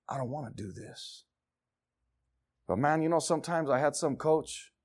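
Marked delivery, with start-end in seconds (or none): 0.1-1.2 very low, quiet defeat; weary, sunken voice; giving up. Acted. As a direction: act drained, barely voicing it. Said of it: "you just wanna give up".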